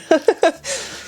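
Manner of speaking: a high little giggle; a high embarrassed giggle. Real, not acted. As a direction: let out a teary high laugh. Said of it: "emotional breakdown".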